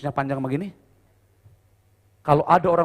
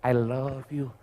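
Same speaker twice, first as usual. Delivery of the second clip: soft, lower murmur of love; soft whisper; 4 semitones lower, 6 dB quieter, much slower than usual. Acted. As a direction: whisper it to a partner who can't hear. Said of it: "dedek nggak dengar".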